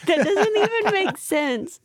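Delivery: high, slow laughing protest. Real, not acted. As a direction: laugh through the words. Laughing reply.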